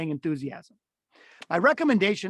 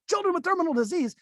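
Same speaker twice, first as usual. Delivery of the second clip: much higher, chirpy and bright about a grim topic; mismatched cheerful voice; 10 semitones higher than usual. Shown as a demonstration. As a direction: say it like happy news. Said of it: "that would be a wild, horrible disconnect".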